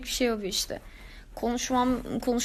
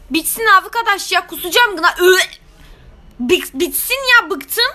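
exasperated; 5 semitones higher, 5 dB louder than usual. Real, not acted. Bıktım çıkışı.

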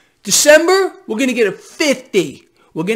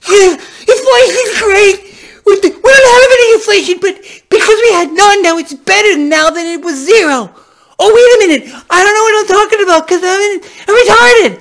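sarcastic shouting rant, much higher and louder; 10 semitones higher, 10 dB louder than usual. Acted. Vlogger mocking the president's inflation claims, sarcastic screaming rant. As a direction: scream it out like a furious mock rant.

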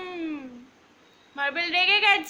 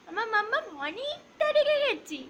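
put-on a light, higher baby voice for the sulking dog; sulky pet voice; 5 semitones higher than usual. Owner speaks for her angry dog, higher pouty baby voice. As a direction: pout it out like a cross little pup.

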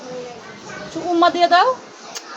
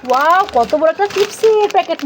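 ordinary delivery

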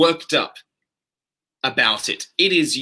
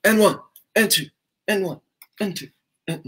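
workout count-in; 6 semitones higher than usual. Shown as a demonstration. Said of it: "各位喜欢workout吗".

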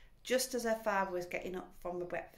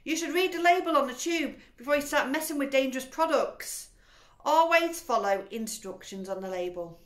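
higher, loud know-it-all scolding; lecturing wife; 7 semitones higher, 9 dB louder than usual. A narrator's reading. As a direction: preach the label rules loudly. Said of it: "Missus Wormwood said".

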